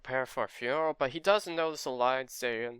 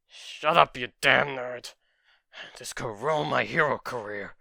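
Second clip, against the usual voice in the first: snarling dismissal. Acted. Bakugo snaps back at Izuku.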